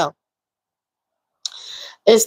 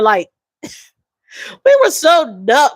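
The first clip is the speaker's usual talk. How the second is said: laughing through tears; 3 semitones higher, much slower than usual. Real, not acted. Laughing at grieving past self.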